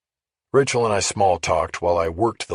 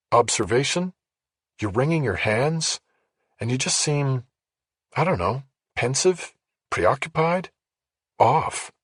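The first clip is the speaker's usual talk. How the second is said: a higher, probing woman's voice; gently questioning, noticing his nerves; Rachel's voice; 6 semitones higher than usual. Acted. Narrator voices Rachel observing.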